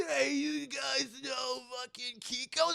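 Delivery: high, slow, strained call; mangled monster voice. Retold or imitated. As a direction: croak it out like a beaten-up wreck. Battered Kuwabara asking girls.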